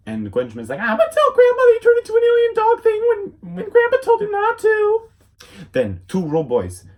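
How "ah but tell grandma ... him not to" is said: very high, loud tattletale voice; squeaky bratty girl. Retold or imitated. Gwen tattling to Grandma in a squeaky voice.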